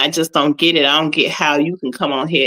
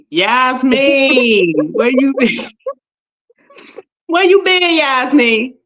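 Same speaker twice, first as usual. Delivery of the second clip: calling out to a viewer; 7 semitones higher, slower than usual. Real, not acted.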